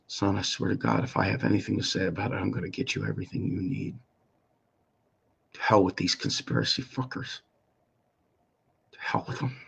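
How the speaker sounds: soft, low-volume resolve; hushed, bitter promise; quiet vow. Retold or imitated. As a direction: swear it to your son under your breath. Recalled silent vow to son.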